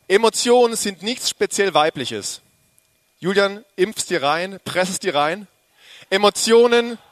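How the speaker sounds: booming declaration quoting Julian's words; emphatic proclamation. Acted. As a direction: preach it at the congregation, slow and forceful. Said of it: "ich hab seine Worte verwendet".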